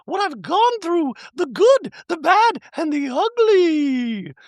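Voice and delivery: very high-pitched mock-chirpy caricature; ditzy reality-star send-up, far above normal pitch; Dorit. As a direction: squeal it out in a silly falsetto. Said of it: "she's like".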